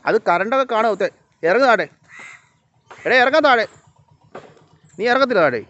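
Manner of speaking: loud, high warning to a pet; high-pitched, loud command to climb down; urgent scolding. Real, not acted. Ordering an animal to climb down.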